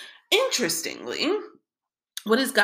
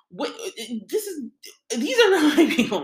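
exasperated outburst; 7 semitones higher, 5 dB louder than usual. Retold or imitated. Moshe voiced in his complaint.

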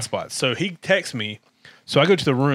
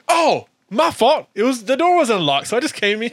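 high mock-apologetic imitation; acting out Spud; 9 semitones higher than usual. Spud caught in their suite, high imitated apology. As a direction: impersonate his excuse, pitched up and amused. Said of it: "And he goes".

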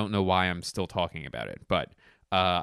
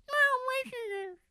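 very high cat voice fading softer; 3 dB quieter, slower than usual. Acted. Cat character pleading with the interviewer, very high. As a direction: squeak it, then let it fade. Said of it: "I'm Adolf Hitler, the cat".

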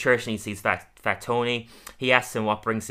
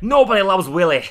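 grumbling Willie; 8 semitones higher, 9 dB louder than usual. Retold or imitated.

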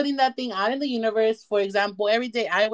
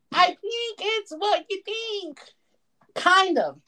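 slow, high put-on voice of a lady in the back; mock audience voice; 8 semitones higher, much slower than usual. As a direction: play a shy audience member, drawn out. Mock audience member answers.

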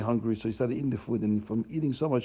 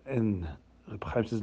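ordinary delivery

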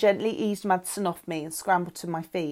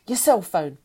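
louder, demanding the phone; yelling; 6 dB louder than usual. A narrator's reading. Frank demands the girls' cell phone, loudly. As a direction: push the words out loudly. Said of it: "he yelled".

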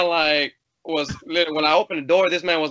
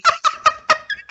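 high laugh; 4 dB louder than usual. Real, not acted.

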